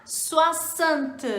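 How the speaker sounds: "Soixante" said "swa-sant", clearly and carefully; French pronunciation demo. Shown as a demonstration. Teacher shows learners the tricky French sixty. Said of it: "Vamos treinar".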